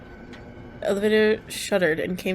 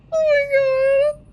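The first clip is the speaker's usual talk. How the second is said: scared; 4 dB louder, slower than usual. Real, not acted. Scared reaction, high drawn out.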